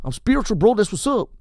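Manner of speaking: a chill bro who is still angry underneath; spiritual gangster. Retold or imitated. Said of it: "East L A gangster gone good".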